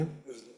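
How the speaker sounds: "of the" as a tiny, soft blur; murmured low, weak "of the"; tiny weak form. Shown as a demonstration. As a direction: reduce "of the" to almost nothing. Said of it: "make it tiny the way we do".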